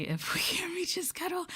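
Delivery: airy high whisper with a giggle; high cute whisper. Acted.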